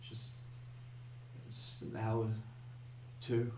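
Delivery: very soft, halting answer; shaken girl near tears. Acted. Shaken girl saying when it happened, faint and halting. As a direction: barely get the words out, pausing between them. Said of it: "she kind of starts to cry a little bit".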